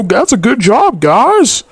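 usual speaking voice

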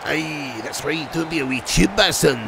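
mock-Scottish teasing; Scottish accent. Streamer teasing a viewer who loved it, playful Scottish accent. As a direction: put on a generic Scottish lilt. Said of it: "It's just a generic, generic Scottish vibe".

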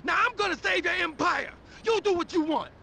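high, rapid angry tirade; fed-up outburst. Acted. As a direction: fire back, fast and high, fed up. Quarrel over blame and the empire.

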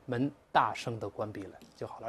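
ordinary talk, nothing marked

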